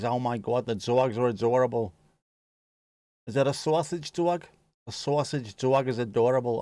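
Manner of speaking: put-on New York accent. Streamer cooing over a sausage dog, mock New York accent. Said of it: "filthy Scouse New York accent".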